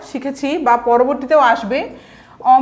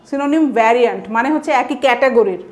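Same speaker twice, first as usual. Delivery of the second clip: ordinary talk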